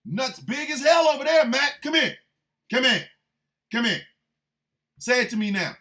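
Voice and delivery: high, loud shouting at Matt to come here; mock confrontation shout. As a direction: call him out across the room, hammed-up. Acted confrontation calling Gaetz over.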